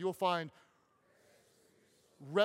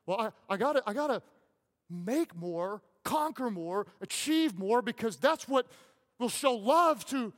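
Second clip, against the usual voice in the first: higher, louder, straining voice; imitating a driven man; 6 semitones higher, 8 dB louder than usual. Pastor mimics a workaholic man, higher and louder. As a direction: push each word like an overreaching striver.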